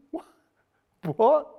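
high-pitched baffled "what?"; incredulous. Acted. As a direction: react in mock disbelief to an outrageous claim. Mock reaction to bold claim.

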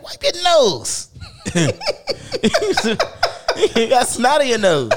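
imitating mom through laughter; mom laughing. Son mimicking his mom teasing about snot. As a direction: laugh the words as his mother. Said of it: "She's like".

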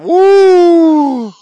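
high loud whoop of relief; relieved woo. Retold or imitated. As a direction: let out a big woo. Relief exclaimed.

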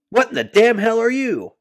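high, loud shocked yell; angry yell. Retold or imitated. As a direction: yell it at a stranger. Dad yelling at intruder.

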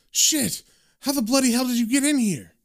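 high-pitched, startled outburst. A narrator's reading. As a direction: jump at an intruder, voice shooting up. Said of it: "Lenny sits up all startled".

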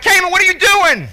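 high, loud shout of disbelief; shocked yell. Retold or imitated. Coach yelling at his son for tripping a kid, shocked. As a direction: bark his name in alarm. Dad yelling at son's trip.

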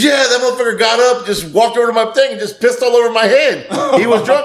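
high, worked-up complaint, not shouted; retold indignation. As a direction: act out an outraged buddy, pitch up. Buddy's indignant account, retold.

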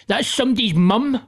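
a mild mock of her scolding; imitating the ex. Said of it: "And my ex was like".

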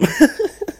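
high, real wheezy laughter; laughing at own joke. Real, not acted. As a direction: crack yourself up.

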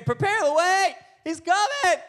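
high, crazed street-prophet call; mock prophet's cry. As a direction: proclaim it like a wild wilderness preacher. Imitating John proclaiming in the wilderness.

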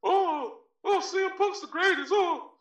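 high, laughing mock fan voice. Mocking fans hyping CM Punk online, in a high swooning voice.

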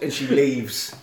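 ordinary delivery

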